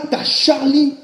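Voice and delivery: ordinary talk, nothing marked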